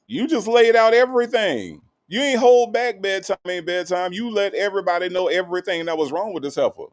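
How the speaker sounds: high, incredulous exclaiming; amazed exclamation. Real, not acted. Host reacting to a viewer's blunt tell-all, high and incredulous.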